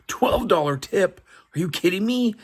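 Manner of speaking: high, indignant disbelief; outraged wife. Retold or imitated. Wife objects to her husband's tip, outraged. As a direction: protest the tip in shock. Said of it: "And gets pretty mad".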